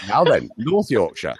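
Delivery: lightly put-on North Yorkshire accent. Shown as a demonstration. Coach showing off to the host, North Yorkshire accent. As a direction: show off a bit of North Yorkshire. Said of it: "I can do a very good Yorkshire accent".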